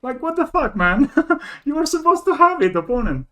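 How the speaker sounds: high, loud disbelief with a laugh; half laughing, half outraged; laughing exasperation. Real, not acted. Player teasing the opponent who had nothing, laughing disbelief.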